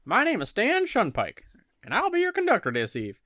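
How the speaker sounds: high and loud, a Wild West bus conductor; Wild West accent. Acted. Stan welcomes Harry aboard in a loud Wild West accent. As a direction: greet him like a stagecoach hand, Wild West accent. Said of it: "kinda like a Wild West thing".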